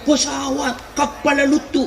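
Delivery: high-pitched mock call to a plane; retold childhood yell. Boy spotting a plane over his village, high mock yell. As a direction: imitate a child calling up to the sky, no real volume. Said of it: "saya teriak".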